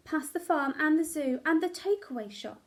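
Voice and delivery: plain reading voice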